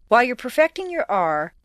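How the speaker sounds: ordinary delivery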